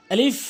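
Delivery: আলিফ ending in a clear f; correct pronunciation. Shown as a demonstration. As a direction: model আলিফ with a long final f. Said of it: "উপরের দাঁতগুলো নিচের ঠোঁটে".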